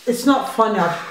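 ordinary delivery

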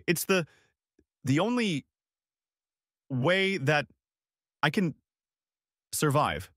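a touch of sobbing, words coming out in fragments; mild tearful breaking. Acted. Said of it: "Suddenly she broke into sobs".

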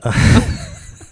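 a quick breathy burst of laughter; short laugh. Real, not acted. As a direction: laugh it off lightly. Laughs after saying no. (ضحكة قصيرة)

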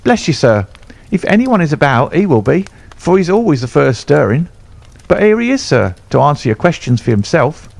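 a nervous stable lad fussing over the gentleman; groom's voice. Acted. Groom figure voice.

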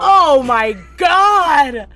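a long drawn-out loud outcry; shocked shout. Real, not acted. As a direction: yell it slowly in disbelief. Sees the pudding snack combo.

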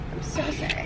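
breathy whispered sorry; whispered apology. Real, not acted. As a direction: whisper it, embarrassed. Repeating apology after dropping food.

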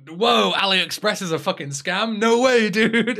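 sarcastic no-way-dude astonishment; mock surprise. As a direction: act stunned, dripping with sarcasm. Sarcastic fake shock at scam.

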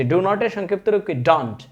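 ordinary talk

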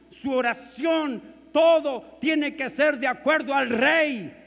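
loud, high, driving pulpit voice; fiery sermon delivery; full-force preaching. Acted. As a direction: hammer the point home to the congregation.

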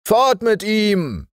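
noch höher und lauter, wie von fern widerhallend; Echo der Mannschaft. Acted. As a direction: als hallendes Echo vom Mast. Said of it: "hallte es am großen Mast wieder". (Mannschaft wiederholt den Ruf, hallend und höher)

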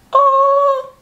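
long, high, loud "ooh"; high-pitched gleeful coo; excited squeal. Real, not acted. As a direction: squeal with delight, held on one note. Delighted reaction to book premise.

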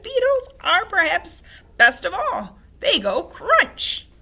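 high, loud crone, very slow; old crone voice. Acted. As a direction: savor each word like a hag. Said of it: "she said".

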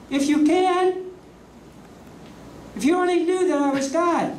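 high, incredulous divine outrage; mock offended God, pitched high; indignant God. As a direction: play God, affronted at the doubt.

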